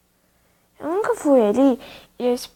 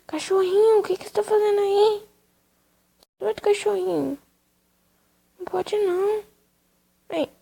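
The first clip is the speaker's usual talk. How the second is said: talking to the dog; 8 semitones higher than usual. Real, not acted. Scolding the little dog playfully, high pet voice. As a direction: scold the puppy sweetly, pitched high. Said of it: "eu sou acostumada a falar assim com ele".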